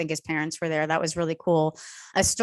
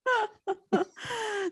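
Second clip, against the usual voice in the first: high laugh; 4 dB quieter than usual. Real, not acted. Laughing at the stairs story.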